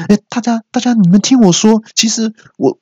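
high, flustered plea for attention; urgent appeal. Acted. Man in dog mask addressing the crowd, urgent appeal. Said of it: "我就马上说".

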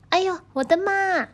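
high, fast panicked cry; frightened yell. Acted. Thief panicking at the door, high fast yell. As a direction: yelp in terror and blurt it out quickly. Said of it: "只听到一位小偷大喊".

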